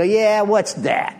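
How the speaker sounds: slow, mocking challenge; smart-ass. Young drunk sassing the old-timer, slow smart-ass retort. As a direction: drag it out like a wise guy. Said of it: "in my smart ass way".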